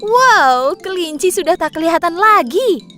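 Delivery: high, loud burst of wonder; amazed exclamation. Acted. Onlooker sees hare vanish from sight, high excited amazement. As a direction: exclaim in astonishment.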